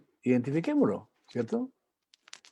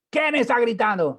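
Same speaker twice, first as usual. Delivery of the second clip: loud, high indignant yell; hammed-up shouting father; mock shout; 10 semitones higher, 6 dB louder than usual. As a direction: bellow the question while denying you shout.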